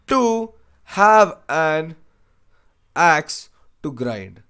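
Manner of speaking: slow, drawn-out "to have an axe to grind"; slow idiom reading. Shown as a demonstration. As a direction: read "to have an axe to grind" slowly, word by word. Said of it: "teacher reads idiom slowly".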